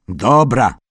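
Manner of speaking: loud, eager yell; child's loud war yell. A narrator's reading. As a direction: bark it out like a boy chief. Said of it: "wrzasnął wielki czarownik".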